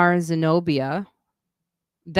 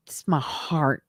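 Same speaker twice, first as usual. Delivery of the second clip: whispered; slower than usual. Real, not acted. Gushing about her podcast.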